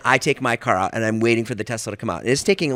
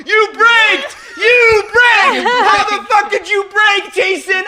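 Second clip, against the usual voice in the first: hammed-up high-pitched yelling; accusing scream; 6 dB louder, slower than usual. Imitating David blaming Jason for the crash, accusing scream. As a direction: hurl the blame at full volume.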